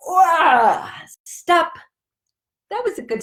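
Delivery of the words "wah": playful yell. Acted. End of stretch exclamation.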